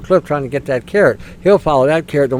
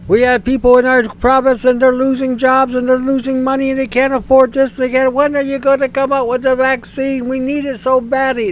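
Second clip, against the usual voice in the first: high, pleading parody of a Quebec MP; mock pleading politician; 8 semitones higher than usual. Mocking a Quebec MP begging for the vaccine, high and pleading. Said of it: "Parti Québécois gets up there and says".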